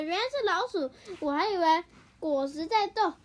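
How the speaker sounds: ordinary delivery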